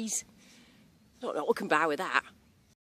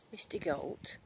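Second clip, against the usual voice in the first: normal talking voice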